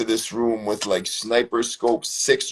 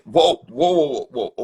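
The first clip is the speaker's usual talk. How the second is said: louder, higher startled whoas; mock alarmed whoa; 4 semitones higher, 4 dB louder than usual. Retelling his alarm at the taser, as a mock startled protest. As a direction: back off fast, hands up. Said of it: "and I'm like".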